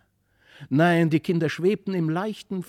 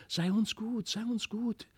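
high, soft lisping doll voices; dainty doll voices, high and hushed; fine little voices; 6 semitones higher, 4 dB quieter than usual. A narrator's reading. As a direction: whisper it like tiny dolls. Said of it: "lispelten mit feinen Stimmchen".

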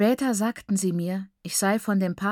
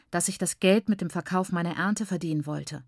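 ordinary delivery, nothing marked

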